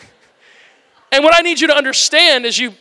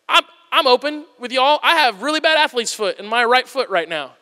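ordinary talk

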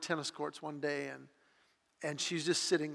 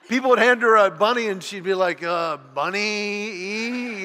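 animated; 4 semitones higher, 11 dB louder than usual. Retold or imitated. Child naming stuffed animals, comic.

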